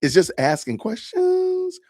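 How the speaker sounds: high, slow, rising like a question; mock upspeak. Shown as a demonstration. Streamer teasing the upspeak habit, high slow rise. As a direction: ask it as a mocking question. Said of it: "is it called".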